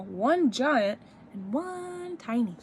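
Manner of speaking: ordinary delivery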